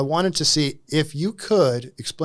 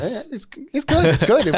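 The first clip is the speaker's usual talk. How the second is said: amused laugh, higher pitch; 7 semitones higher, 3 dB louder than usual. Real, not acted. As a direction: chuckle through the words. Host laughing at playground answer.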